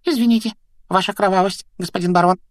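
high, fawning poltergeist; servile, squeaky apology; obsequious grovelling. A narrator's reading. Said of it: "подобострастно заюлил он".

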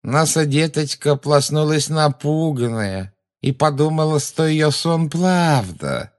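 put-on high, slow, sneering baby voice; mocking baby talk. A narrator's reading. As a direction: coo it like mocking a child. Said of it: "жутко прогнусавила женщина, издевательски подражая детскому выговору".

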